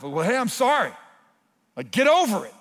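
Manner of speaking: loud, brushing-off apology; mock careless husband brushing off his wife; dismissive sorry. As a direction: toss off the apology like it's nothing.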